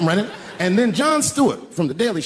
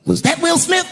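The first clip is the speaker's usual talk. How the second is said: high, loud mock-innocent question; Chris Rock's high quip; 12 semitones higher, 3 dB louder than usual. Chris Rock grabbing the mic and asking the crowd, high and sly. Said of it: "looks at the crowd and goes".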